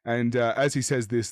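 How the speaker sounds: ordinary talk, nothing marked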